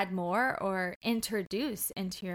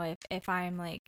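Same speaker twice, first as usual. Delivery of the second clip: ordinary talk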